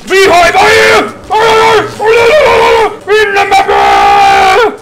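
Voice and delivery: high, loud wails for help; panicked screaming. Real, not acted. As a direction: scream to friends in frantic panic. Fleeing, begs friends for help.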